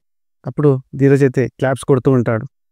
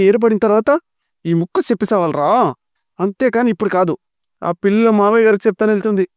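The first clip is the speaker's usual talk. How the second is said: scolding village woman; 7 semitones higher than usual. Acted. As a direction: rant at him like an outraged aunt. Valli keeps scolding Vishwa.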